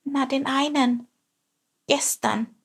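small, high, startled voice; scared little sparrow squeak; frightened peep. A narrator's reading. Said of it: "piepste Chilp erschrocken".

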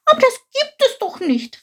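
very high, outraged little voice; indignant. A narrator's reading. Chilp hears the king broke his promise, high indignant cry. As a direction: protest in high-pitched disbelief. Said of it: "rief Chilp empört".

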